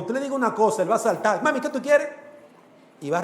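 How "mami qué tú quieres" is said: put-on high, quick voice of an obedient child; eager child. Preacher voicing an obedient child answering his mother, eager and high. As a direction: pipe up like a kid who jumps when called.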